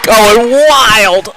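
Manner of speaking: loud, high cheer; excited shout. Real, not acted. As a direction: hype it up with the crowd. Co-host reacts to the block.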